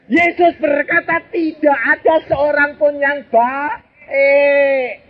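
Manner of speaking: Yesus berkata drawn out slow and high, ending on baik; stress on baik. Shown as a demonstration. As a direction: preach the verse slowly and emphatically. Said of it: "preacher stresses the scripture quote". (mengutip ayat dengan lambat dan tegas)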